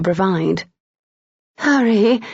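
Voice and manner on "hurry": higher, urgent seductive urging; throaty seductress. Acted. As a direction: urge him like a seductress. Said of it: "in the throaty voice of a consummate seductress".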